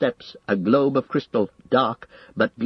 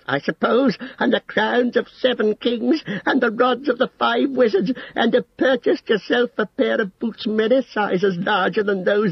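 shrieked high at first, settling toward the end; screaming; 10 semitones higher than usual. A narrator's reading. Saruman raging at Gandalf's demand for his staff, screaming high. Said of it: "his voice rose to a scream".

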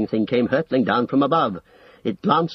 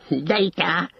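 higher-pitched scornful outburst; rising cry; 6 semitones higher than usual. A narrator's reading. Saruman scorning Gandalf's terms, crying out high. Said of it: "he cried, and his voice rose".

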